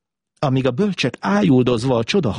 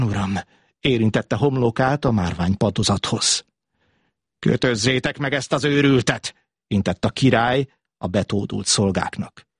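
plain reading voice

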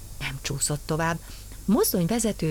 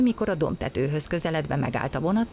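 usual speaking voice

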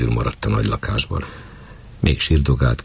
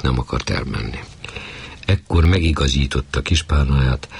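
ordinary delivery

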